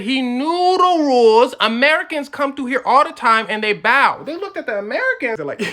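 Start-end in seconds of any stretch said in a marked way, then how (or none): 0.0-4.2 high, loud, "knew the rules" drawn out slowly; emphatic rebuttal. Retold or imitated. Japanese arguing back, loudly stressing "knew the rules".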